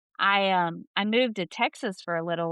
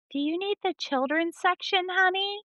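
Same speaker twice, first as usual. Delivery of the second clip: put-on much higher, patronizing voice as if to a child; sweet-talking librarian; 11 semitones higher than usual. Librarian asks caller about children's section, mock patronizing. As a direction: imitate a librarian cooing at a child. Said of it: "the lady was like".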